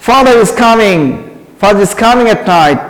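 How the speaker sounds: Father is coming said very slowly; slow model sentence. Shown as a demonstration. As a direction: dictate the better sentence slowly. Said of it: "এটা সবচেয়ে better English". (ধীরে ধীরে উদাহরণ বাক্য)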